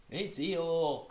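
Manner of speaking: loud, high excited cry; terribly excited. Acted. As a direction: shout it up high, thrilled. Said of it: "cried Roo, terribly excited".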